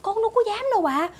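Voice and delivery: high-pitched, hurried protest; alarmed denial. Acted. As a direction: plead innocence to the boss. Denies accusation of stealing. (hốt hoảng chối)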